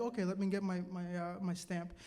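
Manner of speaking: low, hushed, unruffled deadpan; composed, stern administrator. Retold or imitated. Senior administrator calmly fetching her stamp, keeping character. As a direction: keep it very soft and low, giving nothing away. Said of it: "so she keeps character, right? She's no gasping".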